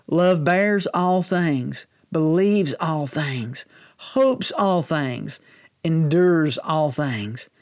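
very slow, weighty Scripture reading. Real, not acted. Preacher reads Paul's words so they get you, slowly. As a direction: draw out each phrase with a pause after it. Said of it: "read this sort of slow".

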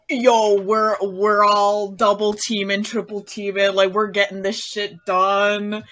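loud, fired-up hype; rally the team at full volume; hyped rallying cry. Acted. Player rallying the party to double team the horse, hyped rallying cry.

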